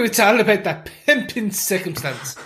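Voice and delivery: hammed-up Bridgerton-style accent; silly unplaced accent. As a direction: put on a jokey period-drama accent. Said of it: "I don't even know what accent that was".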